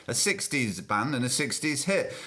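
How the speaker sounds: ordinary talk, nothing marked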